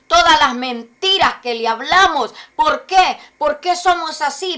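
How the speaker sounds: loud, urgent pulpit questions; full-force preaching. Acted. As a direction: press the congregation hard. (predicación enérgica y fuerte)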